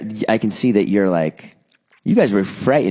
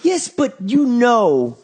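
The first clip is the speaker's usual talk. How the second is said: mock scolding dad; 13 semitones higher, much slower than usual. Continues the scolding dad act.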